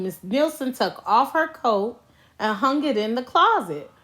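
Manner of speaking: ordinary delivery, nothing marked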